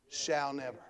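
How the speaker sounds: low, very soft afterthought; hushed repeat. Real, not acted. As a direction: let it sink in quietly after the peak. Same phrase dropped after peak.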